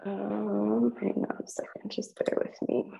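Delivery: soft muttering to herself; half under her breath, fixing the tech; muttered aside. Real, not acted. Teacher muttering to herself while fixing the audio.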